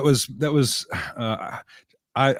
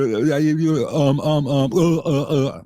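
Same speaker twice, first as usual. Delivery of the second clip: mock stutter, higher-pitched stuck fillers; stuttering under pressure; 5 semitones higher, slower than usual. Shown as a demonstration. Demonstrating a rushed voiceover take, stuttering higher on fillers. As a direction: get stuck on repeated ums and uhs. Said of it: "If I'm under time pressure".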